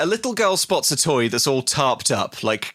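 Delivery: usual speaking voice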